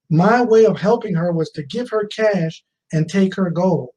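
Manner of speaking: ordinary delivery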